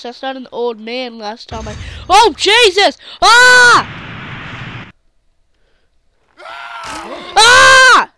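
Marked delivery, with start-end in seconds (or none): 2.1-3.0 sudden loud yelp of alarm; startled yell. Real, not acted. Gamer yelling at a crash on screen. 3.2-8.1 shrill startled scream; high panicked scream. Real, not acted. Gamer screaming at his rider crashing.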